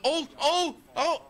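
high, loud mock crowd "ohs"; imitated crowd "oh". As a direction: hoot like onlookers at a crossover. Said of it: "and people like".

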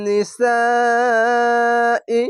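নিসাই with its long vowel held very long and high; four-alif madd stretch. Shown as a demonstration. Teacher showing students the long madd in নিসাই. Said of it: "মাদ্দে লাজিম, চার আলিফ টেনে পড়বেন".

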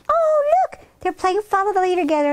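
very high, gushing excitement; delighted exclamation. A narrator's reading. Narrator pointing out the game, delighted. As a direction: squeal with delight, then rush on.

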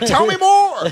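high-pitched mock delight; eager. Acting out an eager mentor.

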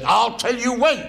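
high, loud drill sergeant bark; barking chief petty officer. Retold or imitated. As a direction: bellow it like a feared naval chief. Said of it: "He said".

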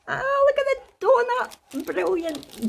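high, drawn-out delighted squeal; excited. Real, not acted. Crafter spots a donut in her parcel, excited.

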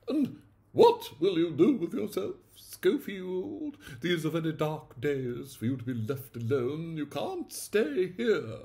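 put-on high, haughty old aunt voice; imperious aunt. A narrator's reading. Aunt pitying her odd nephew, high and haughty. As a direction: peer down at the boy and fret.

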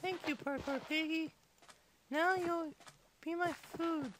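normal talking voice

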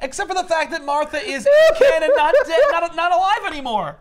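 high, loud, laughing rant; outraged disbelief. Real, not acted. Fan ranting to friends about the comic's ending, high and loud. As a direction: vent your disbelief at the ending. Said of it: "outraged at the book ending".

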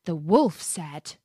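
ordinary delivery, nothing marked

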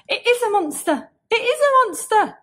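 frightened yell, growing; terrified cry. Acted. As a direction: cry out in terror. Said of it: "he cried".

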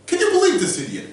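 usual speaking voice

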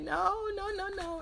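high-pitched mock protest; playful insistent refusal; retold correction. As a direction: object quickly, as if stopping someone. Acts out her correcting people.

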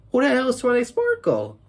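high fast baffled outburst; confused rant. Retold or imitated. Kid voicing Joey confused by ponies, fast and high. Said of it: "And Joey started to say".

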